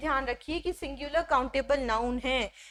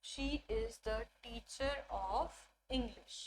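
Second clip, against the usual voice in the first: very slow and softer repetition; a quieter, slow second reading; softer repeat; 3 semitones lower, 13 dB quieter, much slower than usual. Shown as a demonstration. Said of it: "best example".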